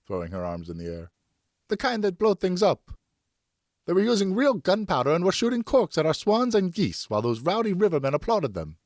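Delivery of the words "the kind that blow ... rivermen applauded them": much higher and louder, indignant; furious mother venting; angry wife. Acted. Wife raging to her husband about the gunpowder, much higher and louder.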